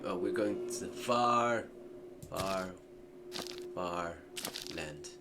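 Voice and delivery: storyteller fade on far; drawn-out fade. Real, not acted. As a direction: stretch each far into the distance. Dramatic trailing far far far.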